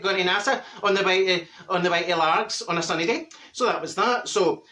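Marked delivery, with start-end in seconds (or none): none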